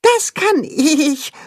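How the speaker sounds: high, slow, eager calf; high proud calf voice; proud little calf. A narrator's reading. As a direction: boast it proudly.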